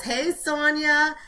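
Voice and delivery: ordinary talk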